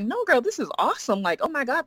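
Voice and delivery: excited friend. Retold or imitated. Friend gushing over the story.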